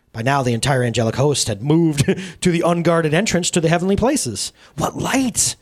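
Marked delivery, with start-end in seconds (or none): 4.8-5.6 awed cry of wonder; awestruck exclamation. Acted. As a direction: gasp it out in amazement. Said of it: "dumbfounded with awe".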